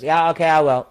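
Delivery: high, loud, dismissive yeah sure; mock breezy agreement. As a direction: toss it off like you don't care. Man dismissively agreeing to mask request.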